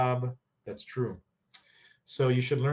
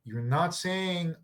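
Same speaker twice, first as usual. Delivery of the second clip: usual speaking voice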